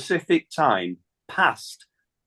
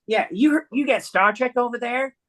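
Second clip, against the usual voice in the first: higher put-on voice for Diane on the phone; imitating Diane; 10 semitones higher than usual. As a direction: play Diane, lifted and bright in pitch. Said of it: "She said".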